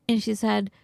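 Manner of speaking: normal talking voice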